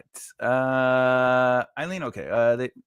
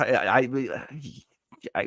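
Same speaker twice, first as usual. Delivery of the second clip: normal talking voice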